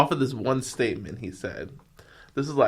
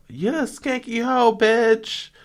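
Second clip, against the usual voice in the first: Mob Wives insult; 10 semitones higher, slower than usual. Acted. Girl snapping an insult in her scene. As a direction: spit it like a reality show fight. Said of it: "I did a Mob Wives scene again".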